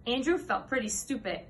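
ordinary delivery

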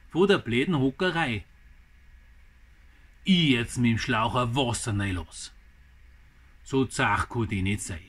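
dramatic Bavarian verse recital in short phrases; poem recital. Acted. Gardener reads viewers his poem about a mouse, dramatic recital. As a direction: recite it line by line with pauses, lively rise and fall.

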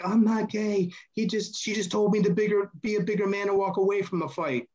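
mock pleading excuse; whiny protest. Imitating Chad protesting.

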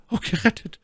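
high falsetto lady, overcome; My Lady stammering. A narrator's reading. Rescued lady to her young officer, high stammered relief. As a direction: gasp it out in a high lady's voice. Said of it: "stammelte sie".

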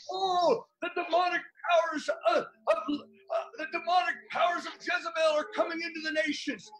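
fast, shouted preaching with a cry in the voice; fiery preaching. Acted. Preacher rousing brothers and sisters, fast shouted preaching. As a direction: thunder it at the congregation, urgent and fast.

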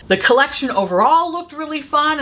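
normal talking voice

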